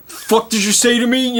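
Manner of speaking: high, confrontational snarl; Jake's aggressive challenge. Retold or imitated. Jake turning on the stranger, hostile and threatening. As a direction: square up and demand an answer. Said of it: "as Jake".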